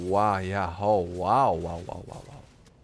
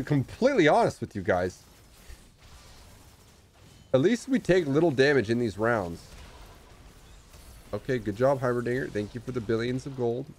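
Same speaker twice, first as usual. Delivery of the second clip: ordinary talk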